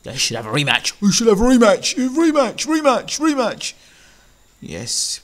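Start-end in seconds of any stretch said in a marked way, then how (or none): 1.0-2.0 high, loud mocking mimicry; mock a pundit clamouring for it; Steve Bunce. Mocking a boxing pundit's call for a rematch, high-pitched clamour. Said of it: "unlike what Steve Bunt suggested". 2.0-3.7 very high mocking chant; Steve Bunce. Mocking a pundit's repeated rematch call, very high parody voice. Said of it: "unlike what Steve Bunt suggested".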